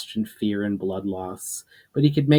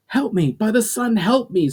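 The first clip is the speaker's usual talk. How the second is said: high, desperate cry for help; pained scream; 12 semitones higher, 4 dB louder than usual. A narrator's reading. Wounded Amjo screaming for help at attackers. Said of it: "screamed Amjo in pain".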